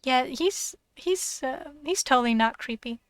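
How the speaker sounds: usual speaking voice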